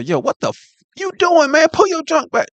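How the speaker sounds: high, loud mock shout at a friend; incredulous yell. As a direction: yell it in disbelief, pitch shooting up. Mocking how he'd react.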